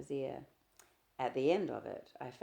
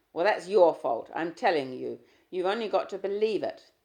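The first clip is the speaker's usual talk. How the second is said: loud, forceful scolding; angry insistence; 3 semitones higher, 11 dB louder than usual. Acted. Husband scolding his wife after the slap. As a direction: hammer the point home.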